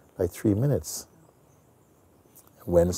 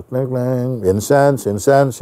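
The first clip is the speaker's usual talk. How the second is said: street vendor call; 6 semitones higher, 5 dB louder, slower than usual. Retold or imitated. Calling out wares on street.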